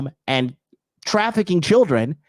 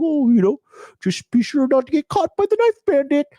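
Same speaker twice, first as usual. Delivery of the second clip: much higher, put-on voice of complacent locals; mocking local people; 10 semitones higher than usual. Mocking complacent locals who shrug off knife crime, in a high put-on voice. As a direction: squeak it out like a clueless, cheerful local.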